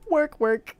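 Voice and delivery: high, loud put-on game voice; Warcraft catchphrase. Showing the line their brother kept sending, put-on catchphrase. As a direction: pipe it up like a game character.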